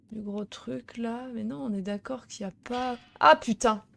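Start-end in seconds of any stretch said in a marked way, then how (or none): 3.2-3.8 sudden loud yelp; startled curse. Real, not acted. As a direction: caught off guard, blurt it. Startled by a trap. (juron surpris)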